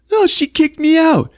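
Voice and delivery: put-on high, indignant kid protest; squealed protest in a put-on voice; whiny brother. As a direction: imitate an outraged kid brother. Acting Melvin protesting at breakfast.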